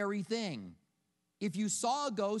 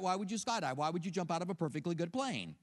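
ordinary talk

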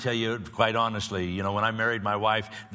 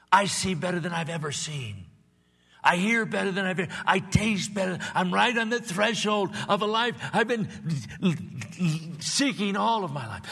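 triumphant preaching; 8 semitones higher than usual. Acted. Grandfather answering his grandchild, in triumphant preaching. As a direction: proclaim it like a victory, stretching the key words.

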